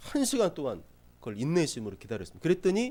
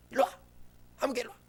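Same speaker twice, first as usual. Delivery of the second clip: angry mom yelling; 9 semitones higher, 4 dB quieter, faster than usual. Retold or imitated. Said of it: "이러시면 안 되고".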